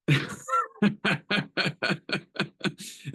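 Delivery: long, bubbling run of laughter; amused laugh. Real, not acted. Host tickled by someone welding on the call, amused laugh. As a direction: laugh it out in quick, high bursts.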